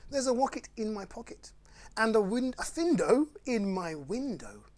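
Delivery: playful bedtime story reading; reading rhymes to children. Acted. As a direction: read the silly rhymes to the kids with relish. Reads Dr Seuss aloud.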